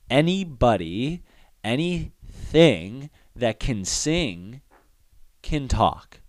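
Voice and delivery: drawn-out emphasis. Real, not acted. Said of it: "emphatic point about singing".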